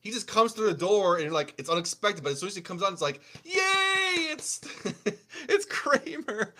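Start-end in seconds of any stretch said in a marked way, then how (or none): 3.4-6.5 excited cheer. Retold or imitated. Crowd cheer as Pac enters.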